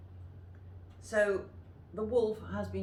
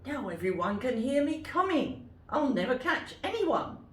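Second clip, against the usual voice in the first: wolf crying out; 7 semitones higher, 4 dB louder than usual. Acted. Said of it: "cried the wolf".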